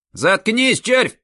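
high loud snarl; barking. A narrator's reading. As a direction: bark the order at him. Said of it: "гаркнул Хромой".

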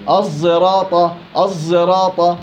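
الزراطة with ص mixed toward ز; slow drilled demonstration; sad scented with zay. Shown as a demonstration. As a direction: voice the sad slightly. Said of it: "بين الصاد وبين الزاي".

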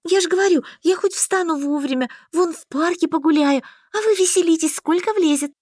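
high-pitched happy chatter; delighted. A narrator's reading. Heroine plans her free day, delightedly. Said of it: "несказанно обрадовавшись".

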